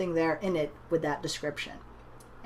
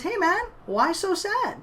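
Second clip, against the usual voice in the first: high, slow, cheery clown greeting; friendly clown; 11 semitones higher than usual. Retold or imitated. Clown leaning down to a sad little boy, high and slow. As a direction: coax a sad kid gently.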